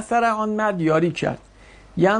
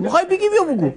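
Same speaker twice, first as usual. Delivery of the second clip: exasperated; 12 semitones higher, 3 dB louder, much faster than usual. Real, not acted. Teacher impatient with hesitant student.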